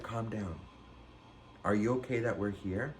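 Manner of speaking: low, soft imitation of a concerned ex; quiet, calming. Ex asks after the frantic narrator, quiet and calming.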